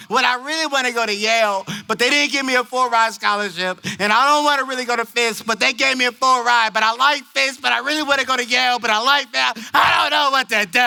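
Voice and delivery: high, rushed, breathless-sounding rant; mock overwrought teen, building to a wail; distraught teen. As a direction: imitate a girl torn and rambling. Torn girl rattles off her dilemma.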